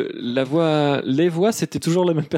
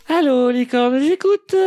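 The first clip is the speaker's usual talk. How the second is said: singsong operator greeting climbing high; 10 semitones higher, 4 dB louder, much slower than usual. Acted. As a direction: sing out the hotline greeting, ending high.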